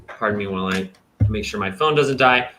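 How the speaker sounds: ordinary talk, nothing marked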